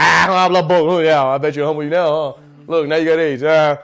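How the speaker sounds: loud, gloating taunt as God; mocking taunt. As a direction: jeer it out, pleased with yourself. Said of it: "he'd be like".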